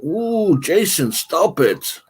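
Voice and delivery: ordinary talk, nothing marked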